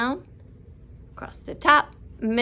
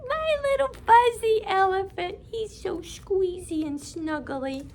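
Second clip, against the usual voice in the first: much higher, gushing over the toy; delighted; 11 semitones higher than usual. Acted. Teacher showing children her stuffed elephant, high and delighted. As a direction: coo over a fluffy toy.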